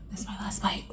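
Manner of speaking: whispered playful confession. Real, not acted. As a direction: breathe it like a secret. Teasing last bite to viewers.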